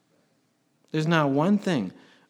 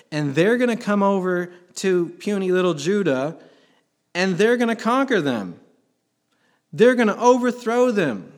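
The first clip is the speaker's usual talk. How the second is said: climbing higher and louder to the peak; rising preacher fervour; 6 semitones higher, 6 dB louder than usual. Real, not acted. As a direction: build it up to overthrow. Preacher building to climax.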